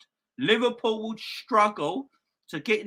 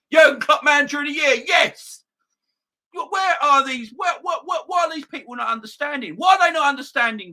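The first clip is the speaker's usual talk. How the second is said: loud, much higher exasperated yelling; ranting shout; 8 semitones higher, 6 dB louder than usual. Real, not acted. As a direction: rant at the doubters at full volume. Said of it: "I'm gonna go on a rant".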